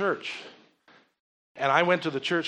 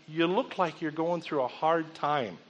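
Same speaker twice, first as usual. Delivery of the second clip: softer, slower concern; gentle; 6 dB quieter, slower than usual. Retold or imitated. Pastor gently telling the waitress she seems burdened. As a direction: ease into it kindly.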